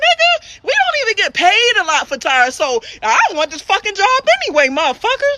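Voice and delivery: high-pitched, sassy comeback, half laughing; indignant retort. Retold or imitated. Mechanic retorting to a sexist tire customer, high indignant voice. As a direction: fire back high and exasperated.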